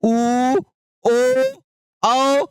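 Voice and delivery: ऊ, ओ, औ each said slowly and clearly with rounded lips; vowel demo. Shown as a demonstration. Teacher shows children the rounded vowels ऊ, ओ, औ. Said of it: "आप कहिए ऊ".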